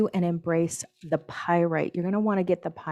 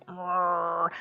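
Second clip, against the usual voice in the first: angry growl. Retold or imitated. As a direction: growl like someone biting back rage. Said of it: "you're like".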